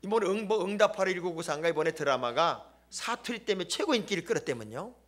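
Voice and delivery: usual speaking voice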